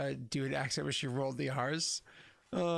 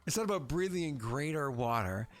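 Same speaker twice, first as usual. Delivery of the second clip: ordinary talk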